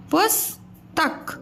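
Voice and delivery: पुस्तक drawn out slowly, syllable by syllable; slow syllable demo. Shown as a demonstration. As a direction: stretch out पुस्तक slowly for the class. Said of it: "स के उच्चारण में कम समय".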